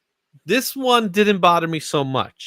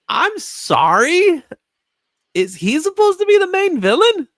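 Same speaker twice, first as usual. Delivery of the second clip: exaggerated disbelief; 14 semitones higher, 5 dB louder than usual. Host questioning a minor villain as the lead, loudly incredulous. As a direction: squeal it in scornful disbelief.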